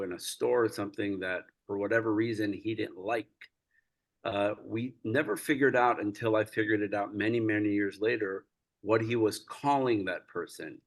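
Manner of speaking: usual speaking voice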